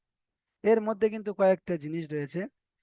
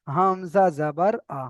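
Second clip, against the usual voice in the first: slow, deliberate letter-by-letter recitation; measured spelling-out drill; slower than usual. Shown as a demonstration. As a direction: spell out "Hamza জাবার আ" unhurriedly. Teacher demonstrates spelling-out recitation.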